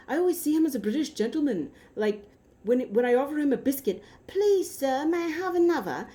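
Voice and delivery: high-pitched imitation of a British accent; high, pleading mock-British voice; British gentleman. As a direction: play a polite little British gentleman. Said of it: "That's how he was talking".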